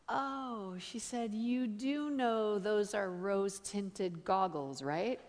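gasp, then soft, low, slow explanation; quiet reveal. Retold or imitated. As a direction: break it to them softly and slowly, imitating a friend. Friend's deadpan reveal after his rant.

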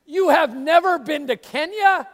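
loud, high, astonished Oh; mock astonishment, stretching never; amazed Kenyan lady. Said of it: "I can't do her accent".

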